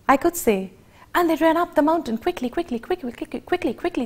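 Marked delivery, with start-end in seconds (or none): 1.1-4.1 fast, rushing narration; fast telling. Shown as a demonstration. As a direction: race through it breathlessly. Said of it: "we can play with speed".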